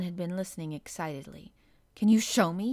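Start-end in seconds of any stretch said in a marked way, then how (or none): 1.9-2.7 put-on loud, pleading boyish cry; eager cry. Colin begging Dickon to show him the exercises, eager loud cry. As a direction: beg to be shown, bursting with eagerness. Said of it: "he cried".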